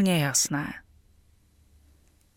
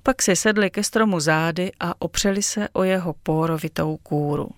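ordinary delivery

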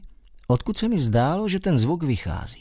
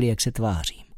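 ordinary delivery

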